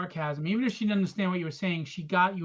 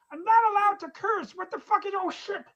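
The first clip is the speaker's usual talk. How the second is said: very high comic protest; squeal-pitched mock innocence, then curse; put-on silly voice; 11 semitones higher, 3 dB louder than usual. Host cursing for his giggling girls, high put-on voice. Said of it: "curse in that voice again".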